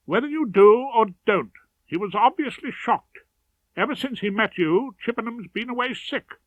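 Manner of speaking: stern schoolmistress. Acted. Teacher confronts the man who upset her pupil, a hint of sternness. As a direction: reprimand the stranger crisply.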